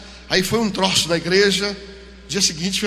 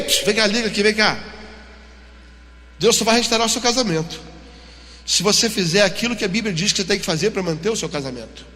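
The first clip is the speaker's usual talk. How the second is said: ordinary delivery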